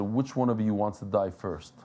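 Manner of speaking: low, quiet, deadly-calm challenge; retold threat, said very calmly and sternly. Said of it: "very, very calmly and very stern".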